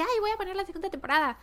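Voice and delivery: quick, high eager thought; excited. Retold or imitated. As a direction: rush it like you can't wait. Retold own eager thought. (emocionada, rápida y aguda)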